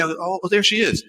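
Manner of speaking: ordinary delivery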